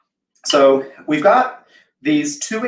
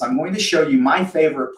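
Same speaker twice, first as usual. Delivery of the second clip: ordinary delivery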